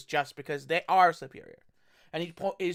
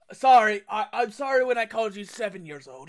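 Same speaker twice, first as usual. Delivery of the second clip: loud, sarcastic apology; mock sorry to the kid; 3 semitones higher, 7 dB louder than usual. Teacher mocking student.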